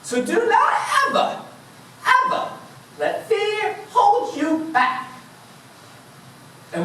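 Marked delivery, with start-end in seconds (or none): 0.0-5.1 high, hammed-up talking bear voice; Hagatha. Talking bear lectures the runner about fear, hammed-up high voice. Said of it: "Hagatha said to me".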